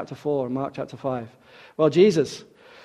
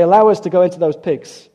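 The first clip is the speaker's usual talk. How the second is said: louder, higher begging; begging demons; 4 semitones higher, 8 dB louder than usual. Acted. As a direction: plead for permission. Demons beg Jesus for mercy.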